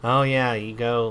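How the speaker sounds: ordinary talk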